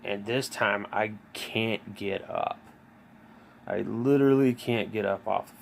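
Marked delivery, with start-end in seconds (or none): none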